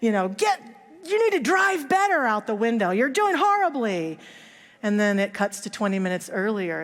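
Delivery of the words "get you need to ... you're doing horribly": retold shout. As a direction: bark it at the driver you're speeding past. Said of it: "he yells at him and says".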